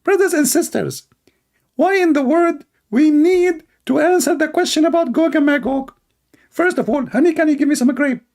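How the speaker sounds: high, mocking preacher voice; imitating Lady Dawa. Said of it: "Lady Dawa, he speak like this".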